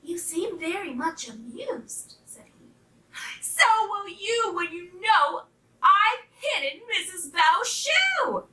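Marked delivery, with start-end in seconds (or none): 0.0-2.2 soft, high little rabbit voice; small inquisitive bunny, quiet and high; curious rabbit. A narrator's reading. 3.4-8.5 high, excited puppy voice; boastful little pup, rising in excitement; gleeful pup. A narrator's reading. Pup gleefully tells his secret.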